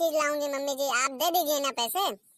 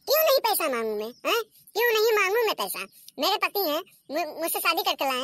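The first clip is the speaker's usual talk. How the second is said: defiant outburst; 4 semitones higher than usual. Acted. Daughter-in-law defying mother-in-law over money, indignant.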